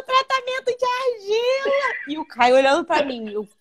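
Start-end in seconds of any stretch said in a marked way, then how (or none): 0.0-1.9 very high, slow, sheepish mother; imitating the mother. Mãe responde envergonhada. (imitando a mãe, bem aguda e lenta)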